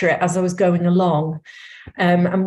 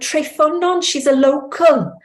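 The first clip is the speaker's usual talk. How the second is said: delighted shepherd; 9 semitones higher than usual. Retold or imitated. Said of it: "he looked at his wife and he went".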